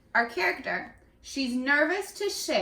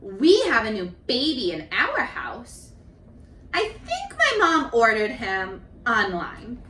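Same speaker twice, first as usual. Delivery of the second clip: gentle storybook reading; reading to children; 4 dB louder than usual. A narrator's reading. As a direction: read each child's page to the class. Teacher reads picture book aloud.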